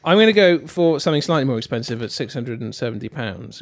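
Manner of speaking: ordinary talk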